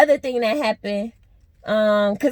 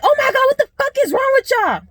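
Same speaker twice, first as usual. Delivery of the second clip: high-pitched mocking imitation of the friend; mocking the roommate; 13 semitones higher, 4 dB louder, faster than usual. Mocking the friend who burst out at the door, pitched way up. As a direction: mimic her squealing complaint, pitched way up. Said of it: "First thing she said".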